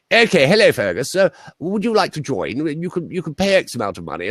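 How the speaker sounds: high, plummy posh recruiter; posh English, pitched high and pushy; posh English accent. Retold or imitated. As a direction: do an exaggerated upper-class English accent. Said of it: "Bosh git".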